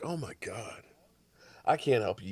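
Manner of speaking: normal talking voice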